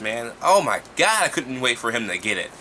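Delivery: usual speaking voice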